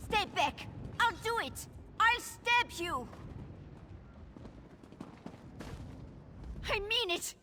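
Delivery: high, panicky threats, backing away; frightened threat. Acted. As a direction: bluff with a knife, terrified. Cornered, threatening with a knife.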